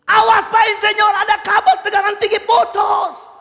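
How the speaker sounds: loud, high urgent yell; shouted warning. Acted. Worker warns engineer of the live cable, shouting. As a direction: project a warning over factory din.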